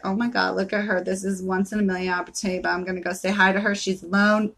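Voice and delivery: quick low aside of an imagined admirer; lightly voiced admiring man. Business traveler spotting a woman alone, lightly voiced. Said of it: "and he'd be like".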